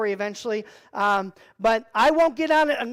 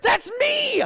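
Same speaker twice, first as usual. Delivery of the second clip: high, sudden exclaim; alarmed realization; 12 semitones higher than usual. Retold or imitated. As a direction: yelp it as you realize you're the prey. Realizes he'll be eaten.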